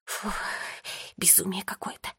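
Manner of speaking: long breath out, then very soft words; whispered after exhale. A narrator's reading. Sister stops short in the quarrel, whispering after an exhale. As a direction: exhale and let it drop to a whisper. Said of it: "громко выдохнула и прошептала".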